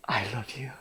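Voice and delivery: very soft and low, near whisper; tender divine voice. Acted. God speaking to a miserable young man in prayer, tender. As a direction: say it hushed, as gentle reassurance. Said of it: "in the clearest voice, he said".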